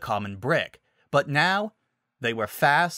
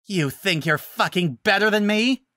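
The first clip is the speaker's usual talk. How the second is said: high furious scream; screaming in rage; 8 semitones higher, 5 dB louder than usual. Acted. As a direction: scream it with hatred. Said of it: "Nightshade screamed".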